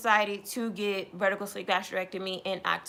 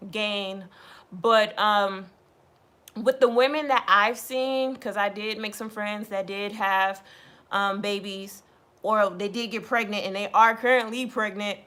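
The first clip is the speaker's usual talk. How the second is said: ordinary talk, nothing marked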